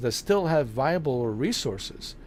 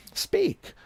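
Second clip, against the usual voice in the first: a tiny high-pitched demand; high squeaky speak. Retold or imitated. Streamer ordering the silent character to talk, in a squeak. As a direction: squeak the word out much higher.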